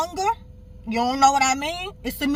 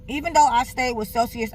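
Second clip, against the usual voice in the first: usual speaking voice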